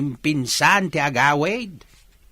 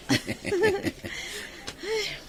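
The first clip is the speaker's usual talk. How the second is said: high cackle; 6 dB quieter than usual. Acted. Father laughs at ex-boyfriend name.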